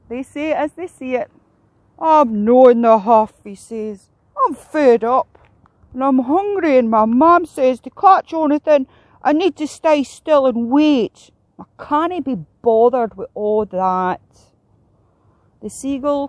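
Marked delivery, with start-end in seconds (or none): 2.0-3.4 loud, drawn-out sulky protest; huffy whinge. A narrator's reading. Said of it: "whinged Heron". 4.4-14.2 put-on loud, sulky young heron complaining; huffy whinge. A narrator's reading. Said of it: "whinged Heron".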